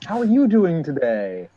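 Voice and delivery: hammed-up cozying up to an old lady; over-familiar charmer. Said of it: "nestles in real close. He's like".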